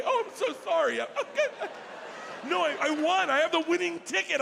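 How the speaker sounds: high, laughing, breathless-with-joy babble; hammed-up thrilled winner; giddy excitement. Giddy winner pushing through crowd.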